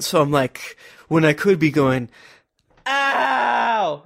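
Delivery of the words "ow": high drawn-out yelp; demonstrated scream. Shown as a demonstration. Player showing what he could do on mic, demonstrated scream. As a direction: cry out as if in pain, for show. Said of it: "screaming into the microphone".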